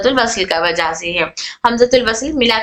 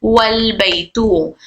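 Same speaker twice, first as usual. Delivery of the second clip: slowly, joining वल straight into बैतू; slow demo; much slower than usual. Shown as a demonstration. Teacher showing students the joined reading, slow and careful. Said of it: "अल बैतू से क्या हो गया?".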